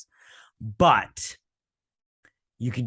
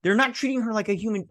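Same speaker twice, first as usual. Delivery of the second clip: high, fast outraged questioning; real indignation; 9 semitones higher than usual. Real, not acted. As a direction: protest the unfair treatment. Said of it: "I'm so angry about this episode".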